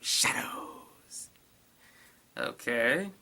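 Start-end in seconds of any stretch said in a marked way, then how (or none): 0.0-1.3 spooky whispered "shadows"; eerie whisper. Shown as a demonstration. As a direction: whisper "shadows" slowly and breathily. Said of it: "Really? Just shadows".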